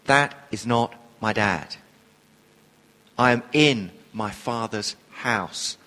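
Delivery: low, soft, deliberate correction; loving but clear. Retold or imitated. Jesus gently corrects Mary about his dad, loving but clear. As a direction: gently set it straight, every word distinct. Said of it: "in love, but very clearly says".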